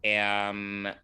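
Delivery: slow, halting, picking each word; measured and guarded, with long pauses; careful word choice. Real, not acted. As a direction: hesitate before each word, then stretch it out. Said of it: "I'm trying to choose my words carefully".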